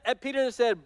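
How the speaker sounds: ordinary talk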